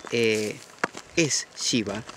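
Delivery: normal talking voice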